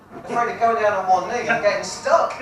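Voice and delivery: normal talking voice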